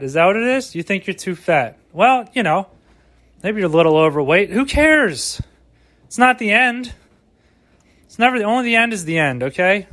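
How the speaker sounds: rally a lazy listener like a cheesy coach; mock motivational speech.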